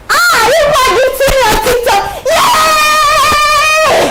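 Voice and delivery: high, excited cheer; delighted squeal. Real, not acted. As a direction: cry out high with glee, stretching the cheer. Delighted reaction to viewers' messages.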